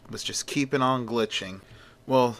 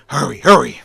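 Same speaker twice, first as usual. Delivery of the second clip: loud, raised-pitch urging; urgent command; 4 semitones higher, 10 dB louder than usual. Acted. Editor sending Olsen off to the crash, urgent shout. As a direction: rush them out the door with a shout.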